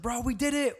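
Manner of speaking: high-pitched mock celebration; retold excited yelling. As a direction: imitate yourself cheering to a friend. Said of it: "I was, like, yelling".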